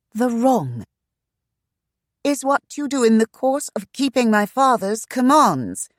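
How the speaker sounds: high, loud sharp retort; flung sharply. A narrator's reading. Shanna answering the agent sharply, high and loud. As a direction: fling the words at him. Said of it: "she flung sharply".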